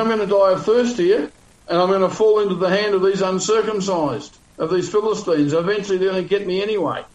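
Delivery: imitating a man protesting his fate to God; accusing. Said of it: "So now he's accusing God".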